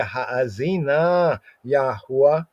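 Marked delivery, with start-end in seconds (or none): 0.0-1.4 Ha'azina said slowly with a long first a, haa-zee-na; drawn-out Hebrew word. Shown as a demonstration. Teacher sounding out the Hebrew for students, slow and drawn out. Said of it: "broaden the ear".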